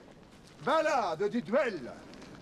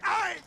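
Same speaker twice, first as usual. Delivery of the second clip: sudden high mocking "aïe"; mock pain cry; 10 semitones higher than usual. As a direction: yelp as if stung, teasing. Mock cry of pain mid-duel.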